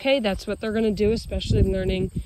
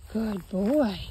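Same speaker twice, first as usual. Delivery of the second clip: ordinary talk, nothing marked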